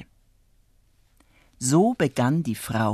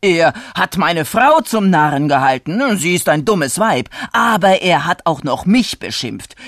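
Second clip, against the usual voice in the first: loud, furious rant; irate farmer hollering insults; shouting; 4 semitones higher, 7 dB louder than usual. A narrator's reading. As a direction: rage louder and higher toward a shouted command. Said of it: "rief er wieder".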